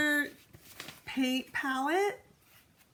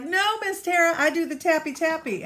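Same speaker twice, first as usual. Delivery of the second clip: lightly acted protesting student, raised and louder; mock young artist; 5 semitones higher, 5 dB louder than usual. Artist contradicting teacher about tapping, mock protest. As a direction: pipe up as a contrary little artist. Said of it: "some artists are like".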